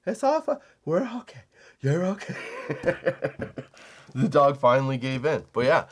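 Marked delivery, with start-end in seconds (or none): 0.0-2.3 calm the wriggling puppy gently; soothing the dog. Retold or imitated. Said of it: "I was like".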